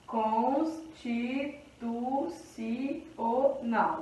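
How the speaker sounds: very slow, soft "Constitucional", a gap after each syllable. Shown as a demonstration. Teacher separating the word for counting, slow syllable by syllable. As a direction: spell out "Constitucional" in separate, evenly spaced syllables.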